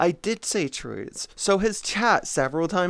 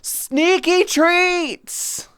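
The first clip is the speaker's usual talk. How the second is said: high, loud and slow, dragging the words out; stretched, squealing sneaky treats; mock alert voice; 12 semitones higher, 4 dB louder, much slower than usual. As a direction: ham up the donation voice.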